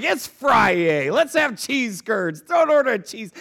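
retold gleeful holler; exuberant dad. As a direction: go big like a dad treating the kids. Dad exuberantly agreeing in retold story.

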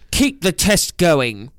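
slow, drawn-out villain command; mock-authoritative boss, stretching the words. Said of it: "authoritative, like, like he's sounding like the fucking villain".

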